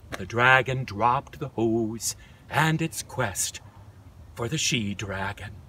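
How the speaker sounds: story narration, dropping. A narrator's reading. Narrator telling children the dragon's sad turn, softly. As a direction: let the sentence sink low and soft.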